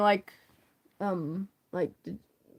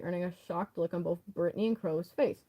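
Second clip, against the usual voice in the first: normal talking voice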